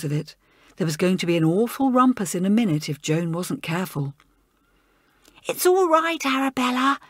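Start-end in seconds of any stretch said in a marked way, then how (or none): 5.5-7.0 hissed. Acted. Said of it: "hissed Elizabeth".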